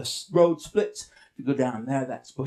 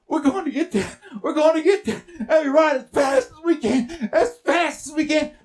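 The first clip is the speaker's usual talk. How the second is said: high, breakneck chase energy; urgent excitement; 8 semitones higher, 4 dB louder than usual. Acted. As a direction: urge the horse on desperately. Urgent race to the water.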